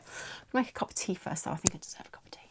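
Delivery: soft whispered aside; whispering. Real, not acted. Hushed aside.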